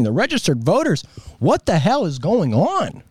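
big swooping pitch on the stressed words; worked-up, sing-song indignation; exasperated rant. Real, not acted. Exasperated rant at election officials.

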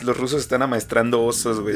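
usual speaking voice

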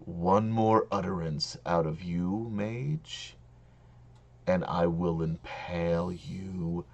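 low, slow, quiet threat; menacing dragon. Acted. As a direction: dragon issuing a deadly warning, softly and deliberately. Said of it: "the dragon ... and says".

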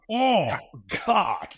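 imitating his own shocked reaction; stung dismay. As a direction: caught out, hammed-up groan of realization. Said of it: "And I was like".

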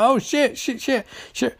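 high, light mock-alarmed yelps; mock panic. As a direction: repeat it high, in playful panic.

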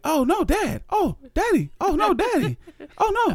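put-on very high worried child voice; little girl voice. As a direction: squeak it like a scared toddler. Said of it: "my daughter's like".

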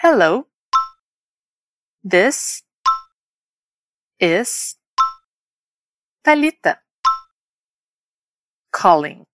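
"Hello", "this", "is", "Thalita", "calling" separated by long pauses; word by word. Shown as a demonstration. Teacher introducing herself for learners to copy, word by word. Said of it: "Repete comigo agora".

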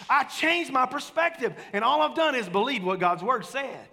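full-force pulpit preaching. Acted. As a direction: preach it out to the congregation.

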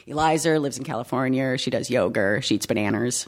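New England intrusive R on "Eliza", "yoga", "bananas"; A endings as ER. Shown as a demonstration. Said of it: "everything with an A has an E R".

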